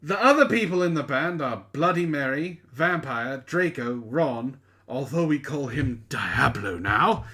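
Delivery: normal talking voice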